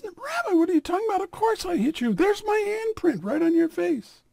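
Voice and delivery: very high, incredulous protest; can't believe what he hears; disbelief. Acted. Man protests to the rabbi in high disbelief.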